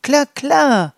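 high, loud trilling bird chorus. Acted. Birds trilling agreement to the dandelions, high and loud. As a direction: trill it out brightly like songbirds. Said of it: "trillerten die Vögel".

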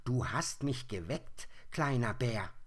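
hoher, lauter, grantiger Igel; übellauniger Igel. Acted. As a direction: schimpf wie ein aus dem Winterschlaf gerissener Igel. Igel spricht weiter. (der geweckte Igel schimpft hoch und laut)

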